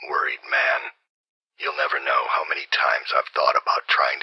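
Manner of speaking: a hard, breathy whisper pushed with force; harsh whisper. Acted. Assassin whispering his contempt over the Regent, harsh whisper. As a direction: hiss the whole taunt out in a tight whisper. Same lines again as a whispered take.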